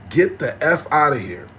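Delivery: angry shout at a troll. Real, not acted. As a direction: yell at the creep in chat. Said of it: "why do I sound so angry?".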